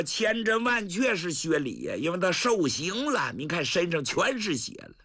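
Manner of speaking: plain reading voice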